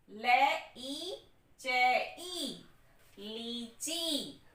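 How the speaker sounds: teacher stretching the long ई in लीची slowly; drawn-out long ई. Shown as a demonstration. Teacher slowly sounding out लीची for children. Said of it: "बोलने में ही देखिए यहाँ पे लंबी साउंड आ रही है".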